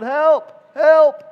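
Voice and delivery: high, loud cry for help; loud storyteller's yell of a trapped woman; retold yell for help. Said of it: "she yelled".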